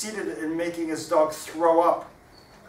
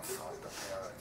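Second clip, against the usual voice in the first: plain reading voice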